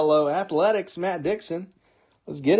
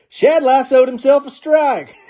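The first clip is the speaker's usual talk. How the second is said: Shad Mayfield voice; 9 semitones higher, 4 dB louder than usual. Retold or imitated. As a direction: play a rodeo champ, pitched up and slowed, breaking into laughter. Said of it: "in my best Shad Mayfield voice".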